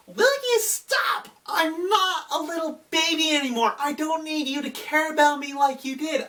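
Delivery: put-on high, snippy teenage voice pushing mom away; bratty teen daughter. Younger daughter snapping at her smothering mother, high and bratty.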